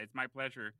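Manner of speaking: ordinary talk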